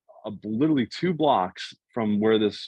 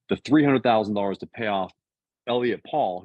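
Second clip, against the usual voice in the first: ordinary talk, nothing marked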